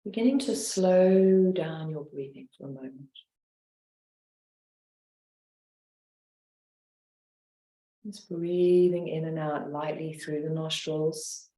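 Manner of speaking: guided meditation. Acted. Leads a guided relaxation.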